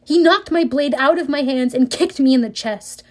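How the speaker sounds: usual speaking voice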